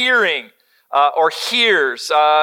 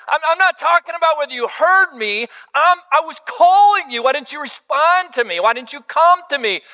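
scolding dad; 8 semitones higher than usual. Retold or imitated. Dad scolding son retold.

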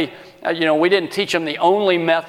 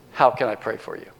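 low, hushed question; gentle offer; 6 semitones lower, 4 dB quieter than usual. Shown as a demonstration. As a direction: drop low and ask tenderly. Models gentle six-word offer of prayer.

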